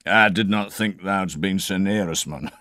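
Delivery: hearty Yorkshire landlord; lightly voiced Yorkshire innkeeper; Yorkshire accent. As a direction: innkeeper jovially answering the Scot, Yorkshire accent. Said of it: "I'm an outspoken Yorkshire tyke".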